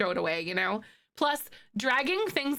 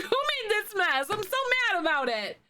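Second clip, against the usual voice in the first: mock exasperated Sim; 9 semitones higher than usual. A streamer voicing a fed-up Sim about the mess, high mock indignation. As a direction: play a cartoonishly indignant Sim, pitched up. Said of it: "And then you're like".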